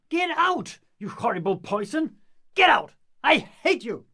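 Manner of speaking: furious yelling at the clinging lover; angry shout. Acted. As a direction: shout her away in a rage. Lysander rejecting Hermia angrily.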